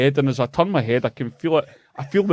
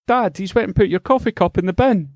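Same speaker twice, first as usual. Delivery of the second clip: put-on high, excited child's voice; high kid's voice grassing someone up; 9 semitones higher than usual. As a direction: tattle like an eager little boy. Kid shouting to his dad.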